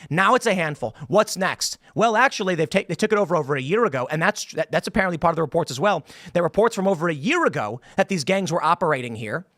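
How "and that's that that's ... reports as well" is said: ordinary delivery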